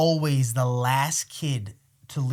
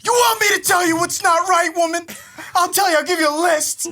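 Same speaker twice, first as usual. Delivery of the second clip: high, loud sarcastic yell; shouting; 12 semitones higher, 6 dB louder than usual. Retold or imitated. Son shouting back at his judging mom, loud. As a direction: hit back hard, shouting. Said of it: "And I'm like".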